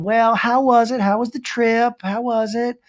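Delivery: high, put-on prying mom; Taylor's mom. Mom asks daughter about the trip, high mock mom. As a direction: mimic a mom pressing in a high voice. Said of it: "gets a phone call from her madre".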